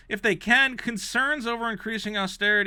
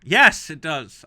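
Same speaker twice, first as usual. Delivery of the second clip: emphatic insistence; 9 dB louder, slower than usual. Real, not acted. Insisting at the review.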